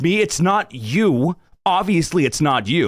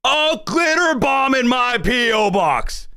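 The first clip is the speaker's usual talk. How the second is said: outraged shout; 9 semitones higher, slower than usual. Real, not acted. Streamer shouts at chat over the glitter bomb threat, appalled.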